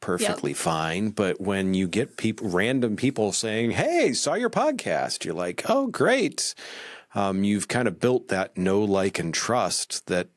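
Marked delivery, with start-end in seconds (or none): 3.7-5.2 high, drawn-out friendly hail; enthusiastic greeting. Retold or imitated. Random listener greeting a podcaster, high and enthusiastic. 5.7-6.5 high-pitched pleased reply; pleased reaction. Retold or imitated. Podcaster answering a random listener's compliment, high and pleased.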